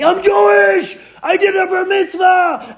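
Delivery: hammed-up joyful yell; retold screaming. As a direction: yell it proudly across the room. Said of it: "he starts screaming".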